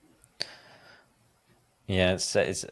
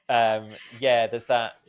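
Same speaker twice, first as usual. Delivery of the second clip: ordinary delivery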